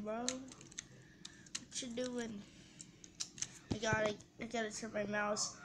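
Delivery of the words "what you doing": drawn-out teasing. Pretend. Teasing another player in game.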